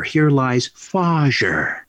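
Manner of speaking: slow "Fajer" stretched out, Dutch-style; sons' nickname "Fajer" dragged out slowly; drawn-out Fajer. Retold or imitated. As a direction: lean into "Fajer" slowly like Goldmember. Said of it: "on my grave marker".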